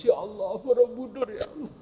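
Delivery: high mock lament; mock wailing tourist. Preacher mocking someone weeping at Borobudur, high mock wail. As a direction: wail it up high, hammed-up and absurd. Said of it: "orang di Borobudur nangis".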